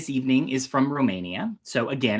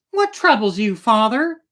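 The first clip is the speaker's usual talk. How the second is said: put-on higher, louder anxious young princess; worried eldest daughter; 8 semitones higher, 6 dB louder than usual. A narrator's reading. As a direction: ask your father gently but urgently. Eldest daughter voiced in story.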